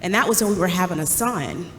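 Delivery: normal talking voice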